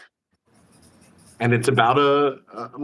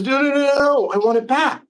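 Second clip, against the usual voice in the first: high-pitched acted plea, no louder; child's protest; 11 semitones higher, 3 dB louder than usual. Girl wants the vanished rose back, high pleading protest. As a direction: beg for it like an upset child.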